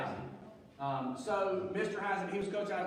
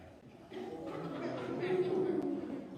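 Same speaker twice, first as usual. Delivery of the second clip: burst of laughter; 10 semitones higher than usual. Real, not acted. Laughing at his thirty-five.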